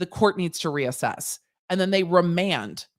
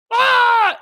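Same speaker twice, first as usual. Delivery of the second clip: a loud, very high delighted shriek; excited scream; 10 dB louder than usual. Retold or imitated. Law students shrieking with glee, high loud scream. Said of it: "And we're like".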